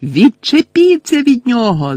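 high, loud angry cry; Lily screaming at the boys to leave Snape; furious scream. A narrator's reading. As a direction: scream at them to stop. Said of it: "закричала Лілі".

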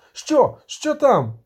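high, loud, eager cry; shouted question. A narrator's reading. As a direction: cry out, demanding to know. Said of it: "крикнув Любисток". (високий гучний вигук)

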